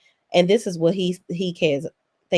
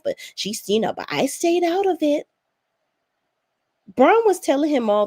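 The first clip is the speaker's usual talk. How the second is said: higher, lively retelling of the party; excited Braun; 6 semitones higher than usual. Retold or imitated. Braun bragging to her husband about the party, in a higher, excited voice. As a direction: gush about staying out of it. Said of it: "she begins to get excited".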